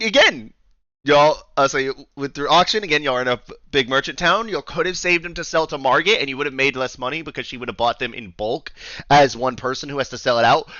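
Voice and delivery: usual speaking voice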